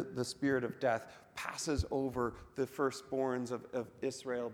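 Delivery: ordinary delivery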